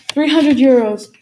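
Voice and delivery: loud, insistent taxi driver; forceful demand. A narrator's reading. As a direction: bark out the price as a demand. Armed driver repeats his demand.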